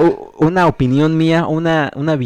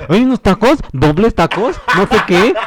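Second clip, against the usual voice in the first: higher-pitched mock version of the other guy; mocking imitation; 6 semitones higher than usual. Retelling how his co-host butted in, mocking imitation. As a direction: parody his interruption in a raised voice. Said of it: "luego este güey".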